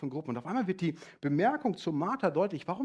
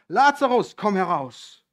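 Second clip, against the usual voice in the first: much higher, loud commanding call; 5 semitones higher, 13 dB louder, slower than usual. Acted. Jesus calling Lazarus out of the tomb, loud command. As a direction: call him out of the grave. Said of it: "rief er mit lauter Stimme".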